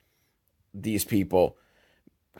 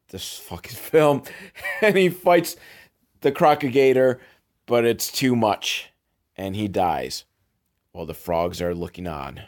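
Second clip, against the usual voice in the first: usual speaking voice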